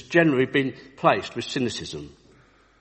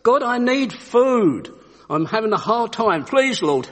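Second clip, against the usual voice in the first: high, drawn-out cry to heaven, easing off; shouted prayer; 8 semitones higher, 3 dB louder, slower than usual. Acted. Old lady praying on her porch for help, shouting. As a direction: call out the plea, starting big.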